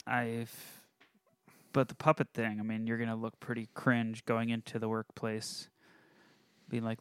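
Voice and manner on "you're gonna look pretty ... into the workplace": ordinary talk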